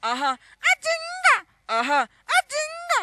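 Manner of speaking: sing-squeal like a shy heroine; high actress voice. Acted. Actress shivering song.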